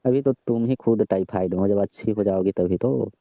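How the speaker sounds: ordinary talk, nothing marked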